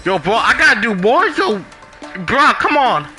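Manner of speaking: normal talking voice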